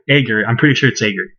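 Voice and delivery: usual speaking voice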